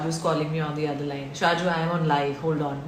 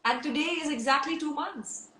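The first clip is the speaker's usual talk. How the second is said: higher, softer voice on the edge of tears; choked up; 7 semitones higher, 5 dB quieter than usual. Real, not acted. To her brother on live, missing him, close to tears. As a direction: let the emotion catch the words. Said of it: "I got so emotional".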